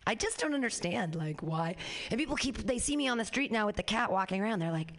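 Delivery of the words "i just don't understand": usual speaking voice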